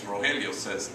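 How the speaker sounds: ordinary talk, nothing marked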